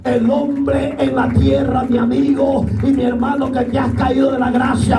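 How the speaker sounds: high, fervent altar call over music; full-force preaching. Acted. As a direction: plead with sinners to come to Jesus.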